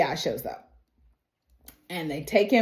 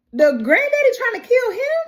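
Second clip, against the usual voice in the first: high-pitched, louder incredulous question; shocked disbelief; 14 semitones higher, 5 dB louder than usual. Real, not acted. Storyteller reacting to grandfather's attack on the grandson, incredulous. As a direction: blurt it out sky-high in amazement.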